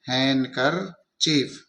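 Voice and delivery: slow "हैंडकरचीफ" as "हैन-कर-चीफ", no D; silent D. Shown as a demonstration. Teacher repeating the word for learners, slow "हैन-कर-चीफ" with silent D. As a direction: break "हैंडकरचीफ" into slow syllables, dropping the D. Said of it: "वो डी है वो silent हो जाएगा".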